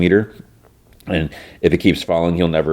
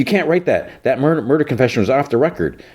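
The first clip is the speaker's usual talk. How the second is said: mock protest; 7 semitones higher than usual. Harley's protest acted out.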